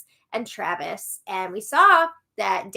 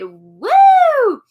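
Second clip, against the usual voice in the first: high, loud cheer; excited whoop; 12 semitones higher, 13 dB louder than usual. Real, not acted. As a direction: cheer them on joyfully. Cheering the super chatters.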